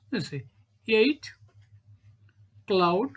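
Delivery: ordinary talk, nothing marked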